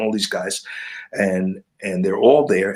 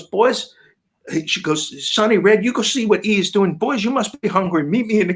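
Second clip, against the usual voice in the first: higher, sweet Southern-aunt voice, welcoming; motherly aunt; 9 semitones higher than usual. Retold or imitated. [name]'s aunt welcoming the boys, high and motherly. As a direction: play a fussing aunt calling the boys in.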